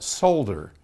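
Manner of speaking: solder with the L sounded, SOLE-der; British pronunciation. Shown as a demonstration. Host showing how the Brits say it, solder with its L sounded. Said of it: "the Brits call it".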